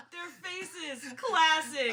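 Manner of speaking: loud cackling laugh spilling into the words; gleeful prankster laughter; laughing hard. Acted. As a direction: crack up at their scared faces. Pranksters gloat after scaring friends.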